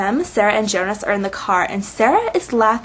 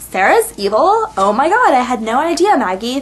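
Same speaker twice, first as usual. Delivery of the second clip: sarcastic shock; 2 semitones higher, 3 dB louder than usual. Pretend. Fan mocking Maggie's obvious reveal, sarcastic fake shock. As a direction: feign amazement at old news.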